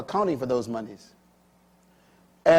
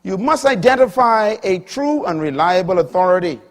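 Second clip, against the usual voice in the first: preaching; 4 semitones higher, 9 dB louder than usual. Acted. Preaching at full force.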